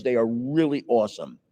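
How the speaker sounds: normal talking voice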